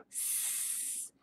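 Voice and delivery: long unvoiced S hiss; snake hiss sound. Shown as a demonstration. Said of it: "Do you hear the sound S makes?".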